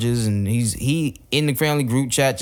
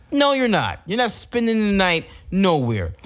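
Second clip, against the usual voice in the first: firm parent; 7 semitones higher than usual. Retold or imitated. Parent refusing daughter.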